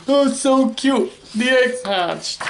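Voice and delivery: high, gushing coo; mock happy crying over the cute chicks; happily tearful Piggy. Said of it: "he's crying 'cause he's so happy".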